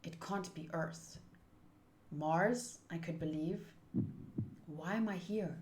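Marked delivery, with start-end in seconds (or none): none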